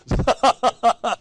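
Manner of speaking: high, loud burst of laughter; hearty laugh. Real, not acted. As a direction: let a real laugh break out high. Laughing at his own retort.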